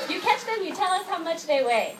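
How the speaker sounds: host dad daring his daughter to catch the chickens; imitating dad's challenge. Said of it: "Daddy would say".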